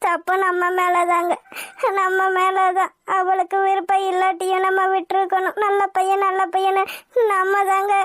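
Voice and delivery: slow, tearful self-reproach; crying. Acted. Wife blames herself and husband for forcing daughter, crying. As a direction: sob out the blame, dragging words. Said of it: "அழுகாதம்மா".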